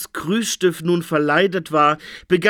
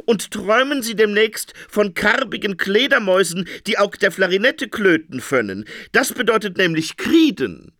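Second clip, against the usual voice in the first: high, oily, friendly voice; cheerful dream interpreter; 6 semitones higher than usual. A narrator's reading. Interpreter greets the Phoenix, oily cheer. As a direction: sound too keen to please.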